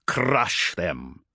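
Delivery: a low, mild commander's snarl. A narrator's reading. Commander ordering the enemy ship destroyed, low snarl. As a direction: spit the order out with contempt. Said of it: "he snarled".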